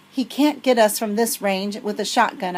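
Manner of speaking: ordinary delivery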